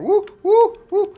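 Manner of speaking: high loud whooping; excited whoops. Real, not acted. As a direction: whoop while jumping. Frantic platform game play.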